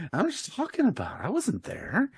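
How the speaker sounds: soft, high-pitched imitation of a suspect; hushed, high mock plea of innocence; mock innocent suspect. Captured killer pleading to police, mock innocent. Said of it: "then she starts, like, going".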